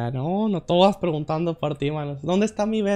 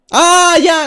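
high, loud burst of realisation; sudden realisation; 10 semitones higher, 9 dB louder than usual. Real, not acted. Player spots the snake in the puzzle, sudden realisation.

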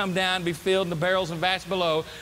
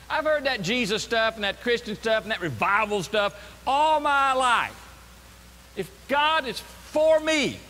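exasperated, rising to a drawn-out protest; frustrated complaint; 4 semitones higher than usual. Acted. Doubter answering God's messenger, frustrated complaint. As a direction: throw it back as a jaded grievance. Voicing the frustrated response to God.

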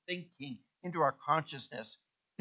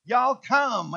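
mock Southern drawl; 6 semitones higher, 5 dB louder than usual. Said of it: "as if you're from Southern Kenya".

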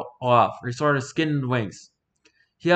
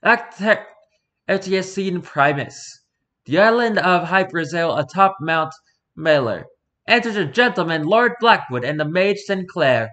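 slow, raised announcement of the act; stage directions; 4 semitones higher than usual. Acted. Narrator opening the play, announcing the scene.

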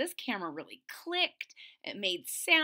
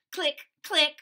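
loud double click noise; camera click sound; 5 semitones higher, 9 dB louder than usual. Pretend. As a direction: snap two quick clicks.

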